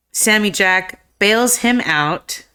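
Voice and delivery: ordinary delivery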